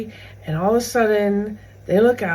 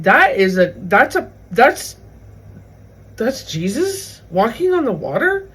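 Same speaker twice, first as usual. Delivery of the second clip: mock-incredulous, loud, halting false starts; disbelieving disciples; 3 semitones higher, 5 dB louder than usual. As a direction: stammer out a retold disbelief, ending on a question. Disbelieving disciples voiced in retelling.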